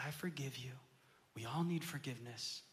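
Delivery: whispered, soft and low; tender forgiveness. Retold or imitated. Father forgiving his son, tender whisper. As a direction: forgive him gently in a hush.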